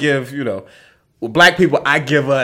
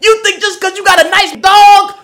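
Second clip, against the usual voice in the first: high shouted rant, voice cranked way up; imitating her yell; 7 dB louder than usual. Man mimicking the solicitor still yelling behind him. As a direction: bellow it like the angry solicitor again. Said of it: "She's like".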